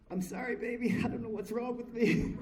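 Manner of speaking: high, loud, laughing sorry; laughing apology. Retold or imitated. Apologizing to his wife after the crack, laughing. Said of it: "Now my wife is awake".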